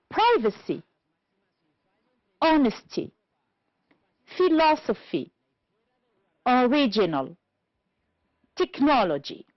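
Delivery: "Privacy, honesty, philosophy, original, technology" stressed on PRI, HON, LOS, RIG, NOL; stress third from last. Shown as a demonstration. Teacher repeating words for the class, "Privacy, honesty, philosophy, original, technology" each stressed third from last. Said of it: "two syllables before".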